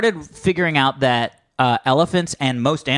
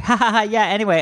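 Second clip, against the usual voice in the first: carefree laughing chatter; 6 semitones higher, faster than usual. Retold or imitated. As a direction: chatter away carelessly, pitched up. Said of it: "be like".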